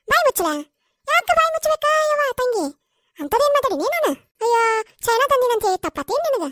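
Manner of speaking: high-pitched, affronted protest; indignant quarrelling. Acted. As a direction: protest hotly at being told off. Acted quarrel over being told off. (ಸಿಟ್ಟಿನಿಂದ ಏರುದನಿಯಲ್ಲಿ ವಾದಿಸುವುದು)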